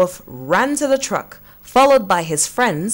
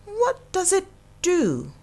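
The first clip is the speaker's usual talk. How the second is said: much higher and softer question; curious cow; 11 semitones higher, 4 dB quieter than usual. Acted. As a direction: ask with innocent wonder. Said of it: "asked Moo".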